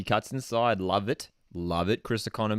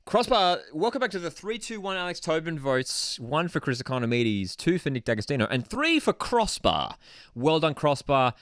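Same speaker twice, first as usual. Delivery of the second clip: mock award announcer; 5 semitones higher than usual. Host announcing player votes with crossbar winning, mock award announcer.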